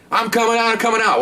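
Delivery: high, frantic calls of surrender; mock bomber calling out. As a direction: voice the suspect crying out that he's leaving. Bomber shouting as he exits.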